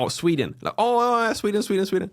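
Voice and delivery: high, excited mock recognition; the penny drops, repeating it eagerly; acted sudden realisation. Listener finally understanding the word Sweden, high mock realisation. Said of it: "I'll be like".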